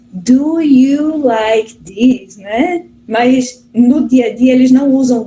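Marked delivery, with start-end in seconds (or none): 0.1-2.4 very slow, every word drawn out; textbook English question. Shown as a demonstration. Teacher shows how language courses teach questions, textbook slow English question. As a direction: stretch out DO, YOU, LIKE and THIS one by one. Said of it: "muitas das coisas a gente aprende no, no cursinho, né, que pergunta".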